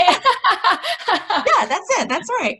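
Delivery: high, loud laughing words. Real, not acted. Laughing at husband's joke.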